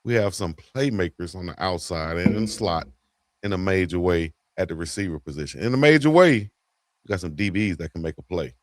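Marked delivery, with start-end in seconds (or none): 5.6-6.3 high, loud catchphrase; catchphrase punched. Real, not acted. Co-host hitting his catchphrase, high and loud. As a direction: hit the catchphrase hard.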